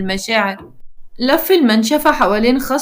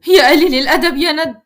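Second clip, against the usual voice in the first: higher, louder outburst; raised, scolding woman's line; indignant insult; 4 semitones higher, 5 dB louder than usual. A narrator's reading. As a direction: throw the insult at him, indignant. Heroine insults the man.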